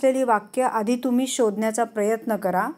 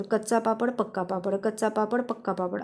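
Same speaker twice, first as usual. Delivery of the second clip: quick, softer run-through, repeated without a break; fast tongue twister; 5 dB quieter than usual. Shown as a demonstration. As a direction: rattle it off quickly and lightly. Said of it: "असं fast म्हणायचं".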